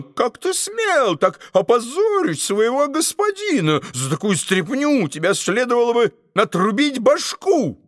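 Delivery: high, furious tirade; angry scolding. A narrator's reading. Duke berating the dwarf over the pie, high angry scolding. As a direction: tear into the cook from on high. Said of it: "закричал он".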